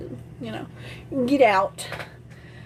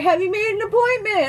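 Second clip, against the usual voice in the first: higher-pitched mock interrogation; imitated nagging mom; 10 semitones higher, 4 dB louder than usual. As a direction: quiz like an insistent mother. Mother grilling her, retold.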